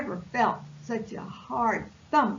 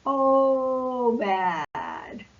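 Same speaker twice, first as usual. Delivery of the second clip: dismayed groan; 5 dB louder than usual. Acted. Cork dreading the jump.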